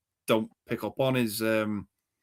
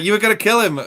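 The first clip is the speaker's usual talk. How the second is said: put-on higher and louder boyish outburst; hammed-up young John; mock kid accusing; 10 semitones higher, 8 dB louder than usual. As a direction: blurt it like a shocked kid. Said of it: "He's like".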